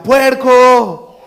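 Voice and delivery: high, loud yell at another driver; acted roadside shout; shouted insult. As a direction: shout it out, stretching the word. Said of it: "y le grita".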